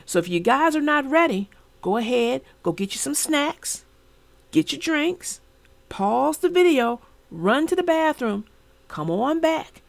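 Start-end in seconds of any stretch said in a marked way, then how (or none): none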